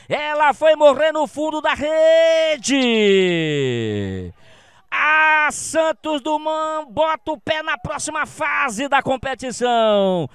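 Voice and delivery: high, loud, thrilled play-by-play; excited goal replay. Real, not acted. (narração empolgada do lance)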